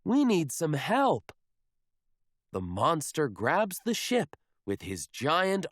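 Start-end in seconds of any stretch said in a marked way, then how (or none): 0.0-1.3 higher, louder plea; calling for help. Acted. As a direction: shout for help to the monster. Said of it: "he yells to the sea monster".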